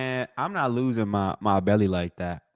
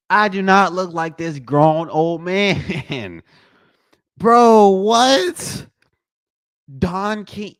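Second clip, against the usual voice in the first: loud, high indignant protest; playful outraged shout; mock outrage; 9 semitones higher, 7 dB louder, much slower than usual. Real, not acted. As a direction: yell at the chat, offended. Outraged by chat comparison.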